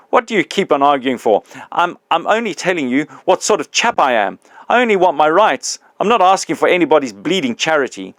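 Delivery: put-on the big ghost confronting his old employee, loud and aggrieved; indignant big ghost.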